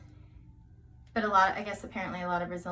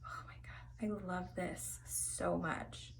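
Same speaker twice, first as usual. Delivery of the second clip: slow, hushed whisper of delight; awed whisper; 3 semitones lower, 11 dB quieter, slower than usual. Real, not acted. Vlogger admiring her finished hair, slow awed whisper. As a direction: breathe it out, almost to yourself.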